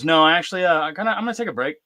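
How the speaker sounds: put-on brisk kid brushing dad off; imitating daughter.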